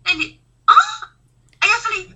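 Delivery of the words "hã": a very high, loud "Hã?"; an old man totally lost on a plane; baffled huh. Retold or imitated. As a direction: yelp it in total confusion. Old man doesn't understand.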